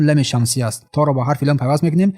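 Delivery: usual speaking voice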